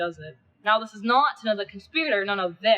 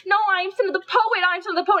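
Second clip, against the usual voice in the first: higher and louder, desperately insisting; panicked plea; 9 semitones higher, 4 dB louder than usual. Acted. Cinna begging the mob he's only the poet, panicked. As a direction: cry out your innocence to the crowd.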